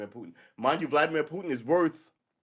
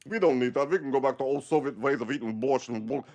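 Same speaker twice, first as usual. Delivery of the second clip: put-on, louder defiant voice; mock a proud Soviet diehard; imitated Russian old-timer; 4 dB louder than usual. Leader telling people to go Soviet, mock diehard defiance. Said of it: "And you're gonna go".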